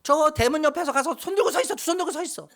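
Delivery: high, scolding father's shout; mock father yelling. Son retelling his father shouting him to the gate, mock yell. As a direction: play the stern dad ordering him out. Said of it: "막 소리를 지르시면서".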